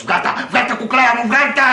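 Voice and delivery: high, loud, urgent lover; mock-shout it like a man overcome by lust; hammed-up demanding lover; eager film lover. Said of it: "Αυτός".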